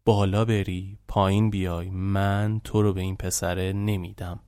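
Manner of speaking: low, soft retold threat; threatening. Father warning his daughter, low quiet threat. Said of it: "با حالت تهدید گفت".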